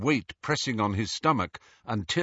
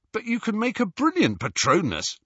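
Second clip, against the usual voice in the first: high-pitched, incredulous protest; 11 semitones higher than usual. A narrator's reading. Ron to Harry back empty-handed, protesting. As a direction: object in a raised pitch. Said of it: "protested Ron".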